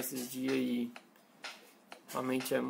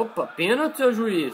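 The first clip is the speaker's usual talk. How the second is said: protesting penalty; 6 semitones higher, 6 dB louder than usual. Real, not acted. Protests foul in game.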